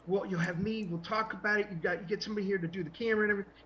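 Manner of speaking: high, eager voice picking up speed; Michael Rooker pitching. Retold or imitated. Actor planning an ad with a comic creator, excited. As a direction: tumble excitedly through the plan.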